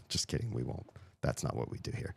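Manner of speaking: hushed, half-laughing whisper; whispered aside. Real, not acted.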